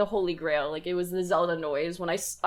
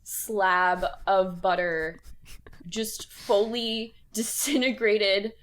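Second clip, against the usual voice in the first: slow emphatic; much slower than usual. Real, not acted.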